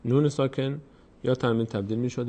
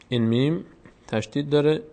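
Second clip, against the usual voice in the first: ordinary talk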